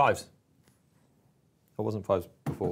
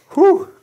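high loud whew of relief; loud relieved whew; 13 dB louder than usual. Real, not acted. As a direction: blow out a big whew. Relief after dice roll.

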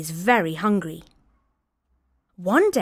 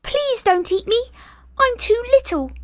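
very high, louder begging little goat; small goat begging for its life; high little pleading voice; pleading tiny goat; 4 dB louder than usual. Acted.

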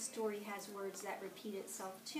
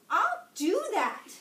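much higher and louder huffy yell; grouchy shout; 9 semitones higher, 10 dB louder than usual. A narrator's reading. Grouchy ladybug storms off to find someone bigger, shouting. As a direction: fling it back in a huff. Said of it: "shouted the grouchy ladybug".

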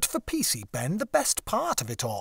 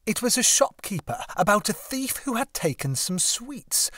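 ordinary delivery

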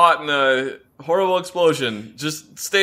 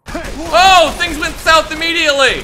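much higher, loud alarmed yell; real panic as the fight breaks out; startled outcry; 7 dB louder, slower than usual. Real, not acted. Fight suddenly erupts.

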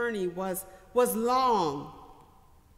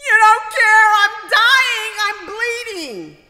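imitated crying child; 11 semitones higher, 14 dB louder than usual. Son wails back at mother.

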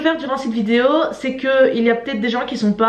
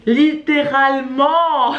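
LITTÉRALEMENT stretched out slowly, syllable by syllable; emphatic; 3 semitones higher, much slower than usual. Real, not acted. Fan confessing her crush on an actor, LITTÉRALEMENT slow and emphatic. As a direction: draw out LITTÉRALEMENT for comic emphasis.

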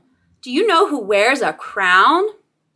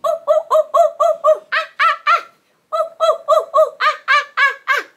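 high monkey hoots; monkey noises; 13 semitones higher, much faster than usual. Acted. Teacher showing kids a toy monkey, high hooting. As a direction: hoot like a playful monkey.

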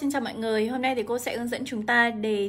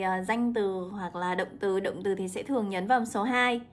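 normal talking voice

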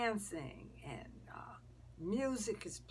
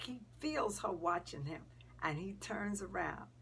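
ordinary delivery

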